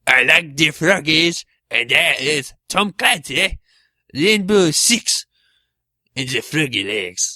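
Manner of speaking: a hint of a hammed-up French accent; lightly put-on French impression; mock French accent. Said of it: "racist French impressions".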